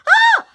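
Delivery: very high, loud squeal of excitement; squealing fangirl shriek; excited scream. Retold or imitated. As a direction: shriek like a starstruck fan.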